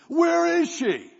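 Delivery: high, slow, loud shout; cop screaming. Retold or imitated. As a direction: bark it like a state cop bursting in. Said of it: "he's screaming".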